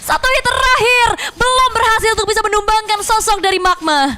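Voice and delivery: fast, high, rising esports call; play-by-play commentary. Acted. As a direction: call the teamfight with rising hype.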